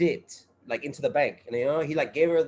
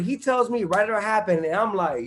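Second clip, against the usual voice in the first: ordinary talk, nothing marked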